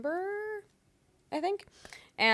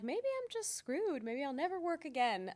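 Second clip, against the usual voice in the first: higher, slower self-doubting worry; mock despair; 7 semitones higher, 5 dB quieter, slower than usual. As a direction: fret half-jokingly that the work is gone. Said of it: "I'm like".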